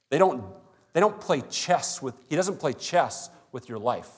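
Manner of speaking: don't hit high and loud, then doesn't; preacher pounding on the denial; stress on don't. Real, not acted. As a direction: lean hard into don't and doesn't. Preacher insisting the Spirit doesn't.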